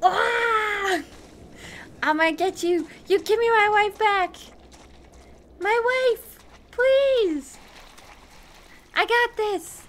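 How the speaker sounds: hammed-up, high-pitched battle yell; brave and angry. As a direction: work yourself up angry to beat the fear. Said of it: "and angry. That helps be less scary".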